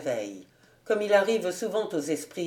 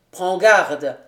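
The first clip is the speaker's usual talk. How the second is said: louder, "prends garde" as a firm threat; stern warning; 2 semitones lower, 9 dB louder than usual. A narrator's reading. Captain warning the valet, a stern "prends garde". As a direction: warn him sharply with "prends garde". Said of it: "répéta ironiquement Lapierre".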